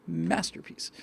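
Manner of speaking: normal talking voice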